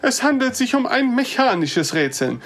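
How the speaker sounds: put-on insistent old man. Distressed old visitor pleads with the dwarf, insistent. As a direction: insist it is mechanical, dismayed.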